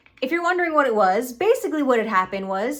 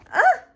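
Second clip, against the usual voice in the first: short, sharp high yelp; startled yelp; 5 dB louder than usual. Real, not acted. Vlogger fighting with a slipping camera, startled yelp. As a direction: let out a loud high "ah".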